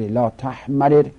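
لا تحمرر loud, with two separate r sounds; لا تحمرر as "la tahmarir"; unmerged form. Shown as a demonstration.

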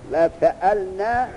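slow, stretched-out recitation of فعلن; drawn-out reading. Shown as a demonstration. Teacher reads the next pattern فعلن slowly for the student.

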